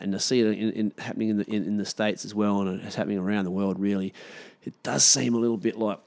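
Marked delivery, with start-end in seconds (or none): none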